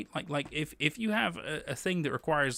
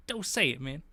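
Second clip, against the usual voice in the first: usual speaking voice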